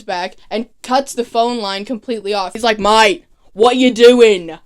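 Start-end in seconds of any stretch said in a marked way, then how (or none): 2.8-4.6 loud, drawn-out mock Australian accent; terrible Australian accent. Slade catching Oliver on the phone, terrible Australian accent. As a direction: put on a bad, exaggerated Australian accent, slow and loud. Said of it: "That's a terrible Australian accent".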